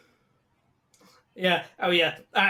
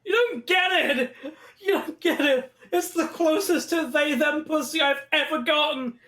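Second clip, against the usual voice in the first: high-pitched mock anguish, half laughing; hammed-up desperate pleading; 7 semitones higher than usual. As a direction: wail that nobody gets it. Acts out Daniel pleading desperately.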